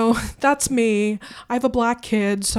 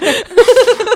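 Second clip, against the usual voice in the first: laughing hard, can't hold it; stifled giggles; 14 dB louder than usual. Real, not acted. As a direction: burst of laughter, high. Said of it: "Trying not to laugh over here".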